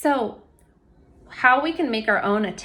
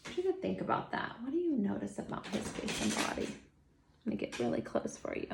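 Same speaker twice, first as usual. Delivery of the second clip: whispered aside; 10 dB quieter, faster than usual. Real, not acted. Teacher murmurs off camera.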